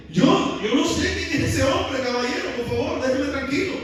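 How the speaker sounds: acted innocent, pleading to be left alone; flustered denial. Pedro brushes off an accuser, flustered denial. Pedro niega, protesta ante el acusador.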